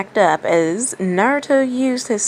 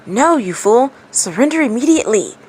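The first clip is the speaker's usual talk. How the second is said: urgent demon voice, a bit louder and higher; hissed warning; 6 semitones higher, 4 dB louder than usual. A narrator's reading. The demon inside Gaara's head warns him, urgent hiss. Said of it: "hissed a voice".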